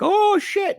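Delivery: very high, loud shocked cry; startled yelp. Retold or imitated. Nikki scared by Rat Boy, shrieking in fright. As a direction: jolt as if jumped at. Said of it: "she's like, she's like".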